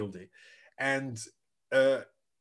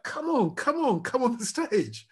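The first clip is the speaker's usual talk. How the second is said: calling him up; 7 semitones higher than usual. Retold or imitated. Musician waving him onstage.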